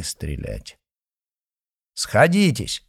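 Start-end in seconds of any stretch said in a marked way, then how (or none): 2.0-2.9 loud, high command; shouted command. Acted. Sokolov commanding the duelists to advance, shouting. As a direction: call the duel start. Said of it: "крикнул Соколов".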